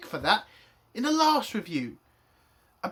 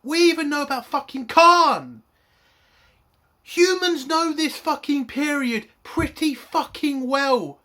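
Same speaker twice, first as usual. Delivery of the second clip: loud, higher exasperated yell; angry shout; 5 semitones higher, 6 dB louder than usual. Real, not acted. Reviewer yelling about Khan at the writers, angry shout. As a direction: throw the fact at the writers. Ranting about show canon.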